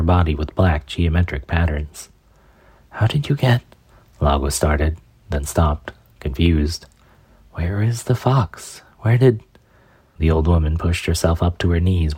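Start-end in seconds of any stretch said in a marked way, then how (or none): 2.9-3.6 startled. Acted. Shock at old woman. 7.5-9.4 confused. Acted. Confused shock.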